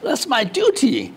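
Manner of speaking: high, slow, stiff English, hammed up; mock consul reply. Consul justifying himself to British TV, mock high and slow. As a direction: deliver the excuse slowly in a high put-on voice. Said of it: "他说".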